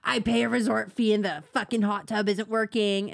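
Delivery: mock entitled resort guest; lightly voiced Karen griping about a fee; complaining Karen. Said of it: "being a fucking Karen about it, cussing".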